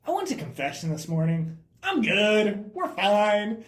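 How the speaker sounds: high, loud mock-chipper voice; over-eager reassurance. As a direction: imitate someone loudly insisting all is well. Imitates Mary's reassurance amid the panic.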